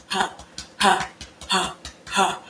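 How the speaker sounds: low, short, primal grunts; soft, deep guttural "huh"; guttural gorilla huffs. Shown as a demonstration. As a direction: huff low from the chest like a gorilla. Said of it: "channel my inner gorilla".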